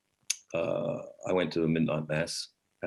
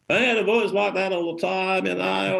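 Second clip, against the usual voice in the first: put-on much higher, louder old Aussie bloke voice; Ron Palmer; 11 semitones higher, 5 dB louder than usual. Old carol host Ron chatting with the narrator, high and loud. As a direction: ham up a cheery old digger. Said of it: "wonderful old guy called Ron Palmer".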